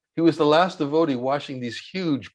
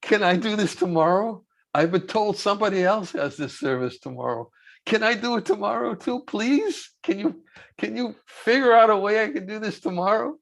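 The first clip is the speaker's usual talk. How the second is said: pleading, pitched higher, mock earnest; begging; 6 semitones higher than usual. Devotee begging the swami to keep his service, pleading. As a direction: plead to do the chore again. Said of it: "he was begging me".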